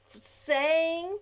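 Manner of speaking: ordinary delivery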